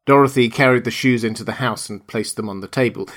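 usual speaking voice

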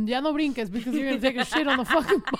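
fast, loud laughing tease. Real, not acted. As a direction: razz him while cracking up. Teasing friend, laughing.

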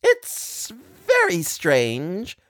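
high madman's voice with wild swoops; crazed maniac. A narrator's reading. Maniac answers the wife's entreaty, crazed. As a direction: muse crazily, stretching and swooping the words. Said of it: "whereupon he observed".

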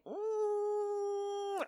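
long, high, soft hum; noncommittal hum. Retold or imitated. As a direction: hum it evasively, drawn out and quiet. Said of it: "Ron Paulson's kind of like".

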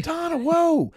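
high, alarmed retold whoa; startled whoa. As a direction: jump up in pitch like you're taken aback. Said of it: "I said".

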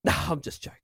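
breathy, unvoiced mock whisper; imitated father-in-law letting the joke go; retold prank reveal. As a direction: drop into a whispered "only kidding". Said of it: "and then he's like".

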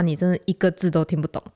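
usual speaking voice

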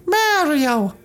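squeaky high Mario impression; a bad high-pitched Mario; terrible high Mario. Shown as a demonstration. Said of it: "my terrible way of saying".

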